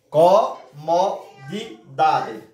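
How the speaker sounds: comodidade slowly split co-mo-di-da-de; syllable by syllable. Shown as a demonstration. Teacher to class, counting the syllables of comodidade, slow. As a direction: tap out each syllable of comodidade. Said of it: "palavra que tem cinco sílabas".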